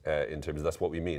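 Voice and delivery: ordinary delivery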